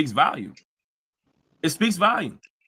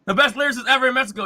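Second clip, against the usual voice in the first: mocking gush; 4 semitones higher, 3 dB louder than usual. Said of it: "and say".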